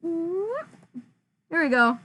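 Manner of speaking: muffled through the mask. Real, not acted. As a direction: talk from inside a fursuit head. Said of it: "muffled voice".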